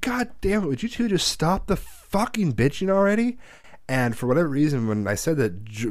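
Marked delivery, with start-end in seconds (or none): 0.0-3.4 high, exasperated yell to stop the bickering; angry yell. Retold or imitated. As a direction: snap at them to shut up, pitch way up. Yelling at bickering mom and sister.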